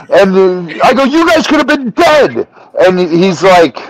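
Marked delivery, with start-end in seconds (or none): 1.0-2.5 high, incensed scolding; retold furious fit. Retelling his scolding of the camera crew, high and angry. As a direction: scold them in re-enacted outrage, pitch raised. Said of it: "I was throwing a fit".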